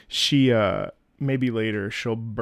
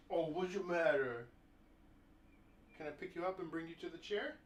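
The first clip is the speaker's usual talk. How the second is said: pet talk; 3 semitones higher, 16 dB quieter than usual. Real, not acted. Talking to dog in pet voice.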